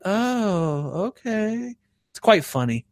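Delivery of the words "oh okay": soft, meek imitation; taken-aback caller. Telemarketer hearing the prankster's threat, softly imitated meek reply. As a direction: mimic a cowed caller backing down. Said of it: "And then they're like".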